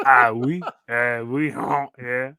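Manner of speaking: hammed-up mock French accent, oui and croissant; a deliberately awful French chef accent; bad French accent. Cooking teacher hams a bad French accent at students. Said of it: "bad French accent that everybody really hates".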